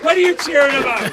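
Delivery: host yelling at the cheering bar crowd in mock outrage; mock indignant shout. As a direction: call out the cheering crowd in jest.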